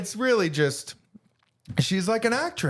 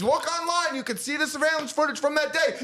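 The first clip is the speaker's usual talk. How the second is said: heated rant; 7 semitones higher than usual. Real, not acted. Ranting about surveillance footage.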